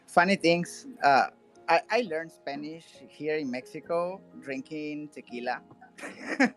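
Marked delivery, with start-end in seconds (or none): none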